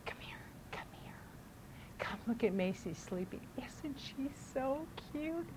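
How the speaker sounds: hushed urgent whisper; seven-year-old brother whispering about his sleeping sister. Retold or imitated. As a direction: whisper so the baby stays asleep. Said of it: "he'll come in and say".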